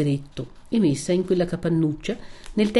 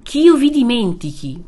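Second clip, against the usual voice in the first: louder, higher echo of che io vi dimentichi; anguished repeat; 6 semitones higher, 8 dB louder than usual. Acted. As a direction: throw the words back louder. Renzo repeats her words. (ripetuto più forte, con angoscia)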